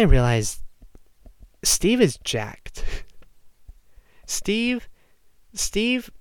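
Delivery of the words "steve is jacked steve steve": mock awe. Marvelling at Steve's strength.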